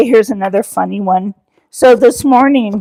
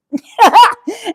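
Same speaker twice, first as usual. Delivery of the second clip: high laugh; 12 semitones higher, 4 dB louder than usual. Real, not acted. Wife recalling husband's panic, high laugh.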